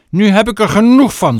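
loud, high furious shout. Acted. Mother loses her temper at Chloe, shouting. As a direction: explode at her daughter. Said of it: "schreeuwde moeder".

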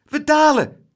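high, loud cry of alarm; shrill-pitched excited yell; shouted. A narrator's reading. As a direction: shout the sudden news. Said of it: "schreeuwde Laura".

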